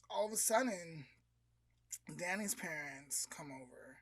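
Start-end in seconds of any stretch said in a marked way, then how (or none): none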